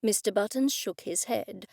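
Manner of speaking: ordinary delivery, nothing marked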